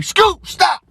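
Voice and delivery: very high, loud strained yelp; panicked reprimand. Acted. Dad stopping daughter's embarrassing remarks, desperate yelp. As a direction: cry it out in comic desperation.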